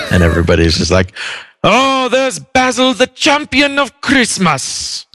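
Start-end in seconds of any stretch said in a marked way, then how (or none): 1.6-5.0 very high, drawn-out mock acclaim; adoring crowd voice. Crowd hailing their champion carried on a throne, high mock adoration. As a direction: squeal it slowly like a starstruck admirer.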